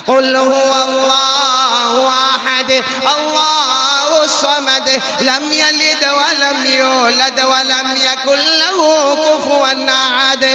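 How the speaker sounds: high, loud, very slow chanted recitation. Acted. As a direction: draw out each word as you lead the crowd.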